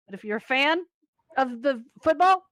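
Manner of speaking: normal talking voice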